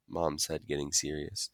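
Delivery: plain reading voice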